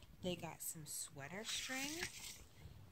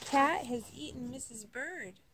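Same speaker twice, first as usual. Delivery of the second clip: crying out; 5 semitones higher, 17 dB louder than usual. Acted. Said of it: "he cried".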